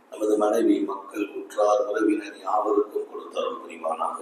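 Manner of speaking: low, soft, devout supplication; a hushed blessing to close the speech; closing prayer. Acted. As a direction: pray over the audience gently.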